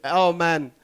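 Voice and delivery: normal talking voice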